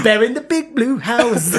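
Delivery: laughing. Retold or imitated. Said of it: "So you just sound like".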